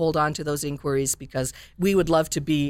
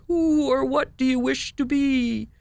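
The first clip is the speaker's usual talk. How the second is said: magic lizard; 10 semitones higher than usual. Acted. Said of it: "said the lizard".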